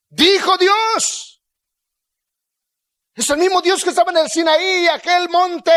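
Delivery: high, loud, ringing sermon delivery; full-force preaching. Real, not acted. Preacher reminding the church that God still speaks, fervent preaching. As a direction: proclaim it with rising urgency, drawing out key words.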